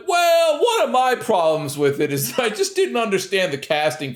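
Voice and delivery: high-pitched mock complainer; mocking friend imitation. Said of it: "he's like".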